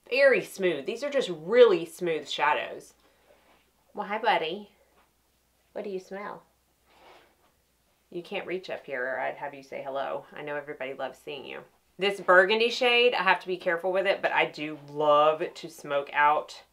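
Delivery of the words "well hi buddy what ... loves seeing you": soft quiet pet talk. Real, not acted. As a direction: coo softly to the dog. Talking to the dog.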